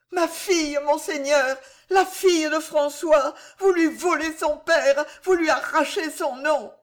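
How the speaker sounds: high, impassioned plea; anguished protest. A narrator's reading. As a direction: plead desperately for your child. Mother pleads for her daughter.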